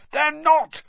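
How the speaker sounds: high, forceful contradicting cry; roaring. A narrator's reading. Ron contradicting his friend at the match, roaring. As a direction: roar back in disagreement. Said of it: "roared Ron".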